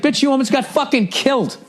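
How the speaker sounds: high mock voice scolding her; high-pitched mock scolding; mocking high retort. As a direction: snap back in a high mocking voice. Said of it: "It's like".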